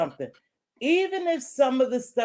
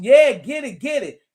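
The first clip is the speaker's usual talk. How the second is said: louder cheer of a hooked viewer; eager egging-on; 4 semitones higher, 7 dB louder than usual. Retold or imitated. Host mimics viewers cheering the drama on, eager egging-on. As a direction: act out a crowd urging it on.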